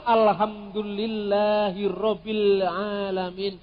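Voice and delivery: alhamdulillahirabbil alamin drawn out slowly; slow Islamic phrase recitation; Arabic recitation. Shown as a demonstration. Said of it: "Tapi kalau".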